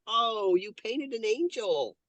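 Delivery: put-on high, slow, wondering child voice; imitating her young son. Her young son voicing surprise about her painting, high and slow. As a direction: voice a little boy discovering an angel.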